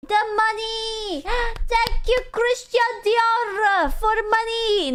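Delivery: high, slow, half-sung gushing with a gasp; mock ecstatic thanks. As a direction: swoon over the money like a diva. Streamer thanks donor for money.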